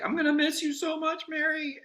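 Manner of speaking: high, over-the-top tearful goodbye; mock sappy farewell. Telling friend Mary he'll miss her, mock-sappy high voice. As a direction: ham up a melodramatic farewell to a friend. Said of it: "genuinely, I wasn't doing it like that".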